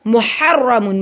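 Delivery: मुहर्रमुन with a vibrating, doubled र; slow मुहर्रमुन, tongue vibrating on र; rolled heavy R. Shown as a demonstration. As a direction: trill the र thickly in मुहर्रमुन. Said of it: "जैसे vibration हो रही है".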